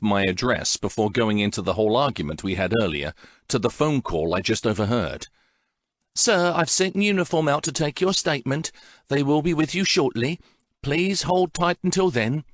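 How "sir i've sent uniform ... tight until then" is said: higher, calm dispatcher voice; operator voice. Acted. Operator telling the caller police are coming, calm. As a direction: reassure him like a call handler. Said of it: "the woman on the other line tells me".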